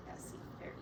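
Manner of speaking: ordinary delivery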